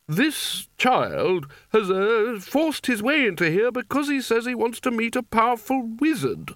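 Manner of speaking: high-pitched, disapproving complaint. A narrator's reading. Spelter complains to wizards about the intruding child, disapproving. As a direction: object in a high, strained-up voice. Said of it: "said Spelter disapprovingly".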